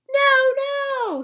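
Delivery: horrified doctor yelling no; doctor shouting. Acted. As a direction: stop her in alarm. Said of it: "shouted the doctor".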